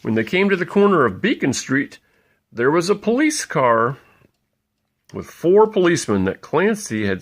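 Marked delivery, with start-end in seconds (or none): none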